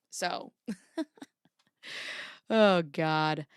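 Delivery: slow breathy laugh through the words; laughing at her own joke, slow. Real, not acted. Laughing at her own fairy bit, slow and soft.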